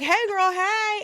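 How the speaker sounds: high, loud sing-out hello; playful imitated greeting; mock breezy greeting. Acting out his breezy entrance greeting.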